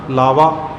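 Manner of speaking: ਲਾਵਾ ending in pure oral aa; without nasal. Shown as a demonstration. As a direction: end ਲਾਵਾ on "vaa", no nasal. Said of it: "ਇੱਥੇ ਵੀ ਬਿੰਦੀ ਤੋਂ".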